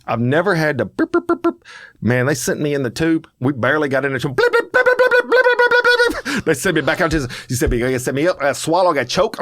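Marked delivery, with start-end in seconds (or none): 0.8-1.6 high-pitched repeated machine blips; short, high repeated noise imitation; imitated machine beeps. 4.4-6.4 very high, loud rapid blips; frantic high-pitched string of machine bleeps; imitated machine blips. Imitating the MRI machine noise.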